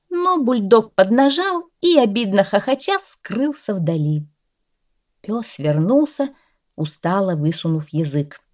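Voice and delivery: ordinary delivery, nothing marked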